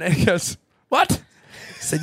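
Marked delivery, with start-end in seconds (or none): 0.9-1.2 high, startled imitation; mock disbelief. Trainer hearing the bloke needs a gun, disbelieving. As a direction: yelp it like a stunned trainer.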